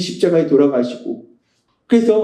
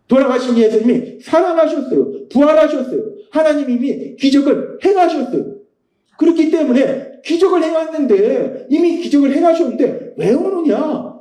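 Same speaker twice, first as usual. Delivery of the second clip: excited proclamation; 7 semitones higher than usual. Real, not acted. Preacher proclaims the resurrection.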